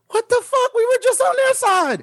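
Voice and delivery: high, squeaky disbelief; mock outrage. Friend voicing a shocked bystander in the prank, high disbelief. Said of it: "the guy next to her is like".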